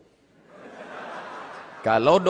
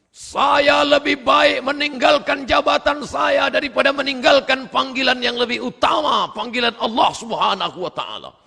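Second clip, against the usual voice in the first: police chief's speech; 12 semitones higher, 4 dB louder than usual. Retold or imitated. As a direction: declaim it like a commander at a podium. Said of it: "Itu pidato Kapolda Sulawesi Selatan". (meniru pidato Kapolda, tinggi, keras dan lambat)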